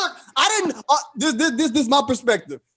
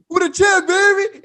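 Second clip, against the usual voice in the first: high, raised taunting voice; imitated gloating winner; 11 semitones higher, 4 dB louder than usual. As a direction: crow like the winner of a fight. Imitates Jerome gloating out window.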